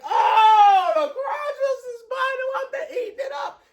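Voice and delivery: high, squealed crowd reaction; high-pitched mock crowd uproar; crowd going wild. Imitates the crowd's stunned reaction.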